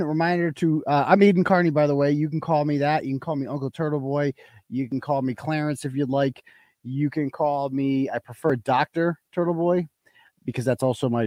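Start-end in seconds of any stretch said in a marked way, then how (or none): none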